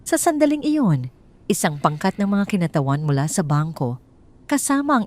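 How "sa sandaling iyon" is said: usual speaking voice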